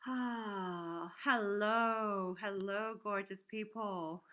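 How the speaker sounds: bright, loud call of hello; loud cheery hello to the chat; excited hello. Real, not acted. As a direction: greet the viewers with a burst of energy. Greeting live-stream viewers.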